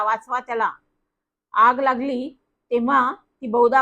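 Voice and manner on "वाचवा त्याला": plain reading voice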